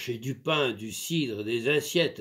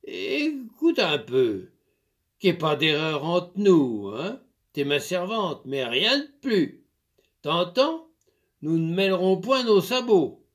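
lightly voiced farmer, firm and a bit louder; stern master; 5 semitones higher, 5 dB louder than usual. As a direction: lay down the law to the servant. Master laying down the rules.

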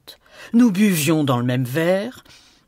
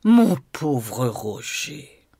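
slow, sighing pity; 3 semitones lower, much slower than usual. A narrator's reading. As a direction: sigh it out with mock pity. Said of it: "Gabriel soupira". (soupir lent et apitoyé)

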